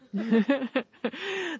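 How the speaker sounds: high, loud chuckle; laugh. Real, not acted. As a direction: laugh out before speaking. Laughs before mountain lion remark.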